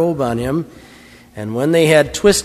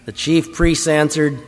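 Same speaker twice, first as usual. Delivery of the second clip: ordinary delivery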